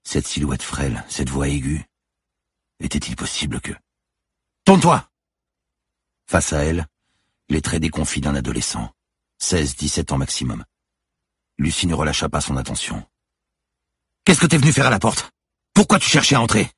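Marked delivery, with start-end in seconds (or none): 4.6-5.1 loud, high command; forceful police shout; shouted order. Acted. Cop orders cornered suspect at gunpoint. 14.2-16.7 loud, high demand; yelled questioning, raised pitch; shouted interrogation. Acted. Cop interrogates the cornered boy, shouting.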